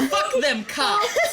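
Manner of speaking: loud, slow laughing cheer; laughing shout. Acted. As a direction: yell it through laughter. Laughing battle cry.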